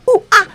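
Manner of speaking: monkey chatter cry; monkey call. Acted. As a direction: hoot like an excited monkey. Monkey hears the alarm.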